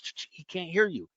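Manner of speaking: whispered shushing; retold hush. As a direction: hush her so the kid can't hear. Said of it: "I go".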